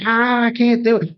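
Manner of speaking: high, strained wail of a terrified soldier; hammed-up terrified cry; mock panicked scream. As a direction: imitate a man clinging to a tree in panic. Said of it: "screaming that he's gonna fucking die".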